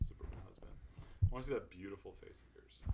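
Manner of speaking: unvoiced whisper. Real, not acted. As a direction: breathe it as a secret. Whispered aside.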